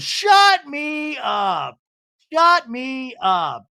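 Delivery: high, very drawn-out yell; mock defiant shout. Castmate daring her rival in a retold fight, slow defiant shout. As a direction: shout it slowly as a hammed-up dare, each word stretched. Acted quarrel, Mary daring Brittany.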